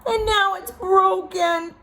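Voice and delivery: very high, loud cub voice; Baby Bear lamenting. A narrator's reading. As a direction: mourn the broken chair like a tiny bear.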